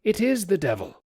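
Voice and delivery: high, frightened exclamation; he's sure it's the devil; panicked. Acted. Sailor fleeing the churchyard ghost, high panicked cry.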